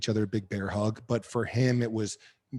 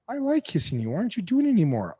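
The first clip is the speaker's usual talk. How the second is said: high mock wife; 9 semitones higher, 6 dB louder, faster than usual. Imitating his wife asking why he stopped kissing her, high and fast. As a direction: voice jumps up high, fast and whiny.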